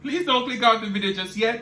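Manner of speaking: ordinary talk, nothing marked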